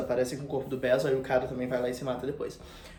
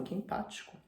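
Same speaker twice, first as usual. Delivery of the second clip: sarcastic whisper; 5 semitones higher, 11 dB quieter than usual. Retold or imitated. Dorian mocking Basil.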